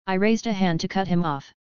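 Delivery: ordinary delivery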